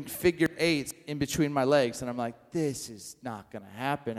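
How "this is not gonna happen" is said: low, quiet and determined; low firm refusal. Retold or imitated. Father quietly refusing the stray cat, low and firm. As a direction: set your jaw, quietly refusing. Cat circles his legs.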